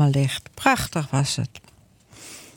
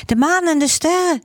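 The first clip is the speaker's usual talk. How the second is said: put-on high child's voice, answering proudly; 12 semitones higher, faster than usual. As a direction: answer like a proud little girl. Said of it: "zegt Marleen". (hoge kinderstem, trots antwoord)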